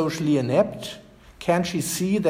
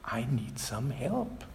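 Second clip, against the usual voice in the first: a hushed, pressing request for help; quietly desperate, slowing on help; more urgently; 2 semitones lower, 7 dB quieter than usual. Retold or imitated. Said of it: "says more urgently".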